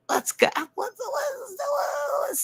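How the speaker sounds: squealing mock cheer breaking upward; excited shout. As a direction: let it rip like a sports cheer. Hyping up the satellite loop.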